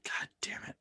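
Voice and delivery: a soft, breathy mock curse under the breath; half-hearted whispered swear. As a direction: mutter it under your breath in disbelief. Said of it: "I'm like".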